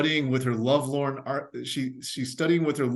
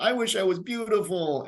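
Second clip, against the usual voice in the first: wishful victim; 7 semitones higher than usual. Retold or imitated.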